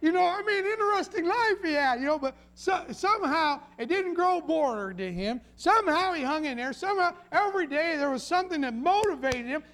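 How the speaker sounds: high, loud pulpit fervour; forceful preacher driving home a resolve; impassioned preaching. Real, not acted. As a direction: preach it with rising conviction. Preaches Noah's motivation at force.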